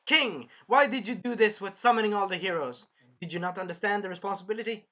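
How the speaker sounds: retold royal scolding; stern questioning. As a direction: demand answers like a queen in court. Acts queen interrogating king.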